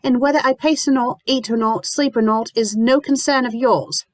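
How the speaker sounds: high cold defiance; glaring. A narrator's reading. Lucy defying the landlady, high and glaring. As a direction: push back hard. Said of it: "Lucy glares".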